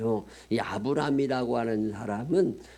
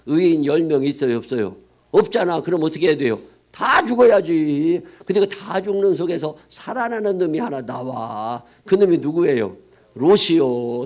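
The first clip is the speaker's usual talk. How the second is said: louder, higher sermon climax; climactic preaching; 4 semitones higher, 6 dB louder than usual. Acted. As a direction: drive the call and response home. Climactic call-and-response.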